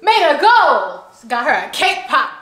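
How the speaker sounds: loud, high-pitched celebration; triumphant cheer. Real, not acted. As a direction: crow about the win, punching each phrase. Celebrating child's first goal.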